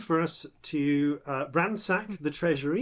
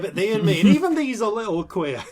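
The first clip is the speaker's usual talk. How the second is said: lightly put-on West Country yokel, higher and louder; West Country accent; 6 semitones higher, 6 dB louder than usual. Host retrying the folk saying in a light West Country accent. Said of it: "I've gone to the West Country".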